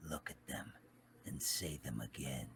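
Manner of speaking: low, soft menacing whisper. Acted. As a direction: dare him quietly. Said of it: "to get Obito".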